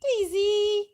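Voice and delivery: high, loud call out; calling a dog. Real, not acted. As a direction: call the dog home from afar.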